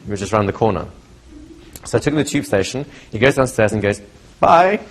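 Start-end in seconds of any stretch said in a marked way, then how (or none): 4.4-4.8 high, loud bright bye; cheery bye. Retold or imitated. Cured client calling from the tube stairs, high cheery bye.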